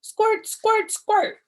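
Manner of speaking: high loud squeal; excited squeal. Acted. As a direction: shout it like a game. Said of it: "I said again".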